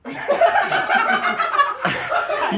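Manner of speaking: high-pitched laughing fit; burst of laughter. Real, not acted. Player cracking up during the challenge, high burst of laughter. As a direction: break into a long, high laugh.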